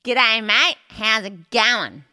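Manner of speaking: loud, twangy Australian accent; hammed-up Australian greeting; exaggerated Aussie. Shown as a demonstration. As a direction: lay on a broad Aussie twang through the nose. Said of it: "give me your best Aussie".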